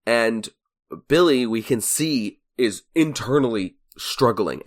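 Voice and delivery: usual speaking voice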